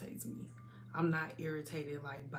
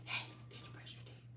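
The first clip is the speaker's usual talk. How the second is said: soft quick whisper; 15 dB quieter, faster than usual. Real, not acted. Mother whispering to her son at bedtime. Mother speaking softly to child.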